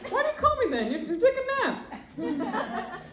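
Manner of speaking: exasperated mom. Retold or imitated.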